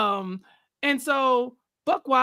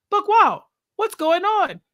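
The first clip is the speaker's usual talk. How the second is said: higher-pitched mock call to someone; 9 semitones higher, 4 dB louder than usual. [name] checks on an upset contestant, imitated concern. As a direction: call out the name, then ask, pitched up.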